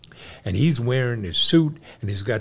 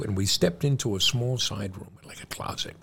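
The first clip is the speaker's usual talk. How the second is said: ordinary talk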